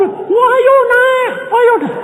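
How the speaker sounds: high, slow, drawn-out cheer; cheering crowd. Retold or imitated. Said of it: "sorak-sorak".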